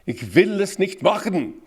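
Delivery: emphatic German consonants. Shown as a demonstration. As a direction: exaggerate every German consonant forcefully. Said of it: "German language emphasizes consonants very much".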